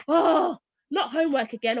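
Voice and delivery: loud groan, then a weary complaint; exasperated parent. Retold or imitated. Tired mother facing her child's homework, exasperated. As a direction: groan as if homework is the last straw.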